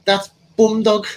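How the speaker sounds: high, drawn-out Manchester impression; mock Manchester accent. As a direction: slowly pronounce it as a caricature Mancunian dealer. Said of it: "And his Manchester accent".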